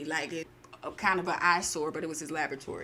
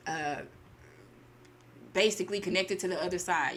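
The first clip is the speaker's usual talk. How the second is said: ordinary talk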